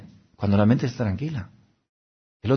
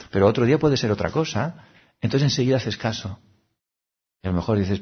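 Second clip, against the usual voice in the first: ordinary talk, nothing marked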